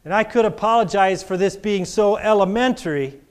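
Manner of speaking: rising preacher's call to the congregation; preaching. Acted. Preacher urging the church to confess, preaching. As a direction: preach it out strong.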